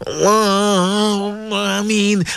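sing-song supplication with long chanted ameens; swaying, chant-like congregational prayer; fervent prayer leading. Real, not acted. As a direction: lead listeners in heartfelt dua, stretching the holy words.

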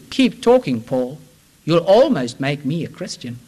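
a touch of dry sarcasm, mostly in the preacher's own voice; lightly mock the apostle, understated; sarcastic. A narrator's reading. Ruler mocking Paul, lightly sarcastic. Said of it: "he said to Paul sarcastically".

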